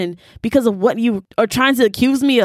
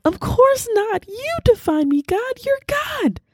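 much higher, small, eager voice; high pleading reply. Retold or imitated. Speaker answering the Lord in prayer, high and eager. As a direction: answer God like a humbled child. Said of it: "And I'm like".

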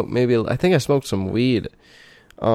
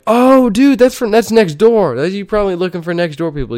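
mock excited roommate; 8 semitones higher, 5 dB louder than usual. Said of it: "he's like".

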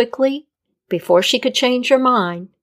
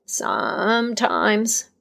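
slow, softer, drawn-out reply; cryptic Death; 4 dB quieter, much slower than usual. A narrator's reading. Death answering the healer cryptically, slow. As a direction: hold back the truth.

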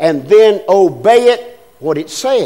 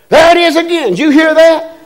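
much higher, louder alarmed cry; alarmed wife; 10 semitones higher, 6 dB louder than usual. Retold or imitated. Wife in bed hearing the scratching again, alarmed. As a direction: panic at the noise coming back.

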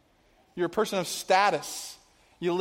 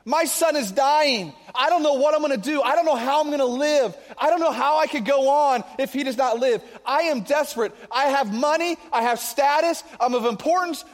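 higher, louder pleading prayer; desperate begging; 5 semitones higher, 3 dB louder than usual. Retold or imitated. Nobleman begging Jesus to heal his dying son, desperate and raised. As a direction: beg as if your son is dying. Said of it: "if he's begging Jesus".

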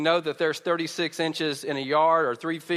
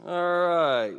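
ordinary delivery

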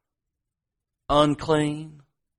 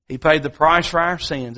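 usual speaking voice

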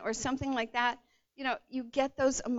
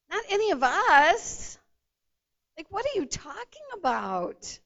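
high, loud disbelief, slowing on none of us; shocked disciples; 7 semitones higher, 8 dB louder than usual. Retold or imitated. Disciples reacting to Jesus's betrayal news, high and loudly incredulous. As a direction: gasp it out as the stunned disciples. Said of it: "they're like".

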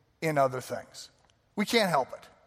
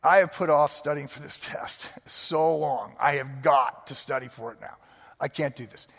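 retold whisper; 3 semitones lower than usual. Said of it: "And I'm like".

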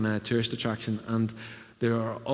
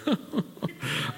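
high breathy mock laugh; 4 dB quieter than usual. Mocking introverts' reaction.

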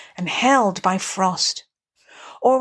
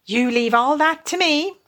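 higher-pitched, take-charge assurance; masterful; 6 semitones higher than usual. Acted. Rat takes over from despairing Mole, masterful. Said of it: "said the masterful Rat".

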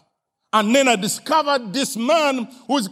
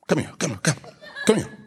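ordinary talk, nothing marked

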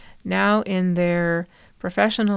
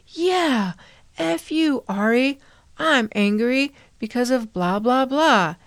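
much higher, louder, imitating a mourner; mock angry outburst; 5 semitones higher, 4 dB louder than usual. Mourner joining in at the memorial, mock angry outburst. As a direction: chime in with a hammed-up curse. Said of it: "somebody else stood up and said".

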